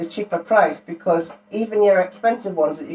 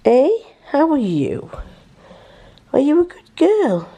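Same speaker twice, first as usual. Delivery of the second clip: higher, cooing voice; pet talk; 8 semitones higher than usual. Real, not acted. Owner asking his cat in a cooing pet voice. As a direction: coo the question at the cat.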